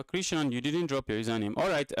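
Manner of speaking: normal talking voice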